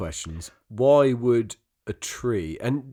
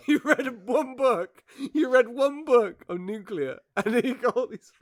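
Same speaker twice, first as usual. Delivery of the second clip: laughing mockery; 12 semitones higher than usual. Real, not acted. Laughing, teasing her cohost over his nuclear reading. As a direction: laugh out the teasing, much higher. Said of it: "laughing mockery of cohost".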